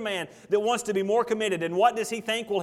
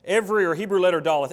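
ordinary delivery

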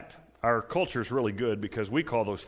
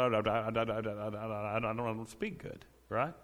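lightly acted stammer on "I, I, I"; mock stutter; 5 dB quieter than usual. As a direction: stumble over the words, a mild stutter. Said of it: "I stutter".

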